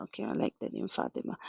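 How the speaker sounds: barely voiced aside to herself; quick breathy whisper of an aside; hushed aside. Real, not acted. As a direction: drop to an almost unvoiced aside. Quiet aside while picking a name.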